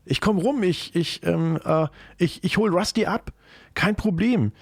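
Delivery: high, stammering placation; flustered boyfriend. Acted. Boyfriend offers to collect his dog, high and flustered. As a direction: stumble over your words, pitched well up.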